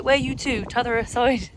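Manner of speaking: "Where you to?" in a broad Somerset lilt; playful broad Somerset accent. Shown as a demonstration. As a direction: lean into a broad Somerset West Country voice. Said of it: "it gets broader again".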